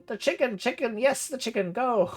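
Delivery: high, urgent batty codger; a batty uncle hurrying the party along; insistent old uncle. Acted. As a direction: shoo them off to the chicken. Gerhard insists on the chicken.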